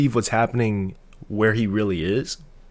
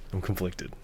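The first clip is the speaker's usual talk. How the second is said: softer, to himself; quiet; 2 semitones lower, 10 dB quieter than usual. Shown as a demonstration. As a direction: mutter it under your breath.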